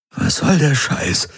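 krächzend, hoch und langsam; gekrächzt. A narrator's reading. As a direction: heiser herausgekrächzt. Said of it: "krächzte Garland". (gekrächzte Frage)

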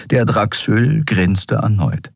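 ordinary delivery

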